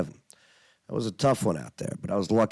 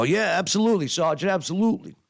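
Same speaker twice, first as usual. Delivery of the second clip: brisk eager New York reply; lightly played New York talk; New York accent; 7 semitones higher, faster than usual. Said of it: "You really talk like that?".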